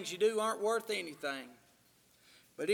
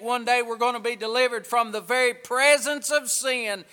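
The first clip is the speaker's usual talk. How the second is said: loud, fervent preacher's call to the church; preaching; 2 semitones higher, 12 dB louder than usual. Acted. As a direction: preach it out loud and full of fire. Preaching at full force.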